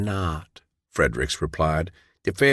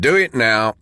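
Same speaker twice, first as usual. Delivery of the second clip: loud, higher guard's shout; 4 semitones higher, 5 dB louder than usual. Acted. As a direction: sergeant yelling at John to back off. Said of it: "the man shouted".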